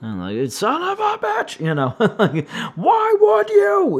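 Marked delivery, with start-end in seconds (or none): 0.5-1.6 much higher, exasperated yelp; high-pitched curse of frustration; mock yell at the cats. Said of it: "yelling at the cats". 2.7-4.0 much higher and louder, dragged-out shout; slow, shrieking scold; retold yell at the cats. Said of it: "yelling at the cats".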